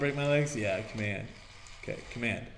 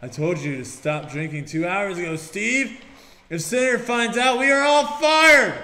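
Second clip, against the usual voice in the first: panicked boss voice; 5 semitones higher, 7 dB louder than usual. Acted. Voicing game characters' scene.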